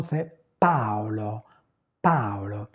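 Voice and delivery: "Paolo" with a and o both sounded; two separate vowels. Shown as a demonstration. Teacher giving Italian for French Paul, two distinct vowels in "Paolo". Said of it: "Deux voyelles différentes".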